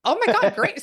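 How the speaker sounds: surprised. Real, not acted. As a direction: blurt it out, truly caught off guard. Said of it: "I get very surprised".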